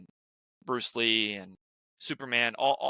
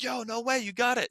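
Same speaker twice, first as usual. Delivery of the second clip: excited crew; 11 semitones higher than usual. Retold or imitated. Crowd cheering dad on set.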